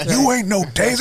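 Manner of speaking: mock taunt from a Western; hammed-up movie quote. Friends trading Tombstone quotes, hammed-up taunt. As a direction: exaggerate the famous film line. Repeats film line, louder.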